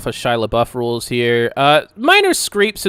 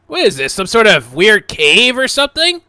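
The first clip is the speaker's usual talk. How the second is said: Alex, puzzled adventurer; 9 semitones higher, 9 dB louder than usual. Acted. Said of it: "He says".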